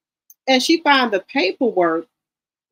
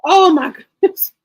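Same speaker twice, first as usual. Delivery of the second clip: higher, stunned oh my goodness; shocked gasp; 6 semitones higher, 4 dB louder than usual. Real, not acted. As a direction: react in real disbelief. Host reacting to shocking clip.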